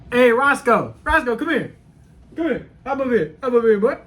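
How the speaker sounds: high, loud calls to a dog; calling the dog. Real, not acted. Owner calling his dog across the room, high and loud. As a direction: call the dog over eagerly.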